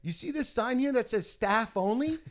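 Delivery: high, emphatic mock telling-off; imitated indignant shop guy; rude scolding. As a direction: lecture a trespasser. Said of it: "rude American".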